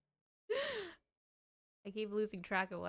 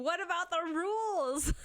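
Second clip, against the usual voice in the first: high, louder mock-prissy voice; mocking Sandy; 8 semitones higher, 4 dB louder than usual. Streamer imitating Sandy nagging about the ban, high mock voice. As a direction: mimic a stickler asking about rules. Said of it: "Sandy's over here saying".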